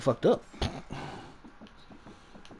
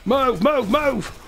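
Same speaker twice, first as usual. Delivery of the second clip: urgent yell; 7 semitones higher, much slower than usual. Real, not acted. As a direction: hurry the team out. Urging team to flee.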